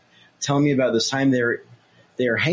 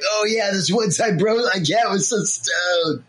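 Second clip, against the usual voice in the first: stoner bro; 7 semitones higher, slower than usual. Retold or imitated. Mimicking a friend's stoner anecdote.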